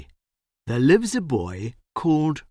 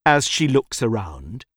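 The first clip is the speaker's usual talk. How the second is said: plain reading voice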